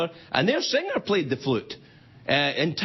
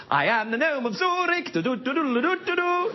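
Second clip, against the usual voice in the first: mock gnome announcement; 9 semitones higher than usual. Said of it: "he jumps out and says".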